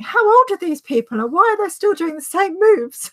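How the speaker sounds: high, loud disbelieving squeal; incredulous mockery. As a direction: scoff at aging pop stars, loudly. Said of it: "She was like".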